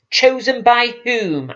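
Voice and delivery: high, scornful retort; contemptuous. A narrator's reading. Queen scorning the prince who claims the crown, contemptuous. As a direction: sneer the question at him. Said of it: "asked Morothai contemptuously".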